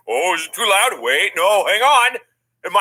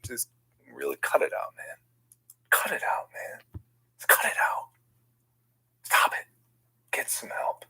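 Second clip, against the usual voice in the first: whispering; 7 dB quieter than usual. Real, not acted. Whispering at the game.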